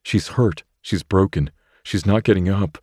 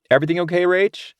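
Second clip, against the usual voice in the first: put-on higher girl's voice, called across the park; Dylan calling out; 12 semitones higher, faster than usual. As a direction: shout to Rachel from far off. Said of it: "Dylan yells from the other end".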